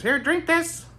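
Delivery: very high-pitched character voice; high eccentric character voice; Bobby Justin. Retold or imitated. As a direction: ask in a high, put-on voice. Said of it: "do a Bobby Justin impression".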